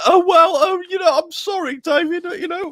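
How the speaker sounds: high-pitched mocking grovel; exaggerated whiny, humble-pie sorry; tongue-tied apology. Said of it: "You were a little bit".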